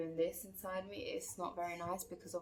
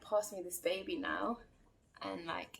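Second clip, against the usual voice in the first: ordinary delivery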